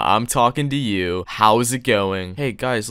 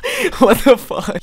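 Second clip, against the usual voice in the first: shocked; 12 semitones higher, 6 dB louder than usual. Real, not acted. Shocked by rabid-sounding kid.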